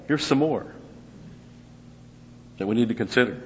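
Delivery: normal talking voice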